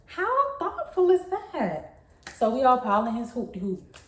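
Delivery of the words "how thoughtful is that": mock gushing. Gushing over boyfriend's mall offer.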